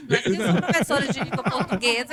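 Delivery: loud, high-spirited talk through laughter; rindo alto. Real, not acted. Reage rindo à pergunta sobre palavras. (falando alto entre risadas)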